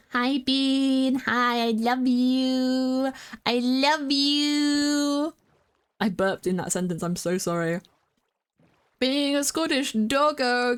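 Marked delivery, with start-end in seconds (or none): 0.1-5.3 slow, high cooing; drawn-out affectionate coo. Real, not acted. Cooing at a friend.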